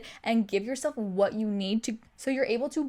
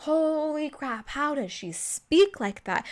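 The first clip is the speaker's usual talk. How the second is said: slow, drawn-out, higher-pitched awe; mock amazement; 4 semitones higher, slower than usual. Voicing everyone watching her speech, amazed. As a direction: stretch it out as an awestruck crowd. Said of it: "Everyone's like".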